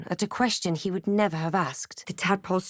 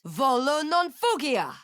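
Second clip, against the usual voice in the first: higher, shouted Latin spell; shouted incantation; 7 semitones higher than usual. Acted. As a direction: hurl the spell words out.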